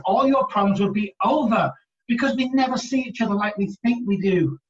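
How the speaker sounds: ordinary talk, nothing marked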